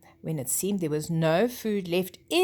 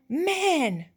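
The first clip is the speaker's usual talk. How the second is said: loud sharp warning cry, pitched up; warning shout; 9 semitones higher, 4 dB louder than usual. Acted. Great prince warns the herd of danger, loud cry. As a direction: call out the danger to the whole herd.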